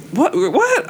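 shocked what. Retold or imitated. Stunned by blunt implant question.

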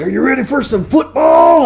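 loud, high hyped announcer; hammed-up jock; jock kid. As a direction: shout it like a sports fan. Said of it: "be like".

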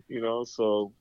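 ordinary delivery

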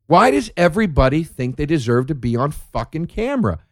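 ordinary talk

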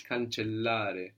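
"cancellare" slowed, with both L's sounded; teacher's slow model of "cancellare", long double L; double L held. Shown as a demonstration. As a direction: linger on the LL in "cancellare". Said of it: "And two L's".

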